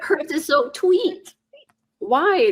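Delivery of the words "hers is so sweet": put-on slow, higher cooing baby talk. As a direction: drag it out like talking to a toddler. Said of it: "this weird baby talk thing".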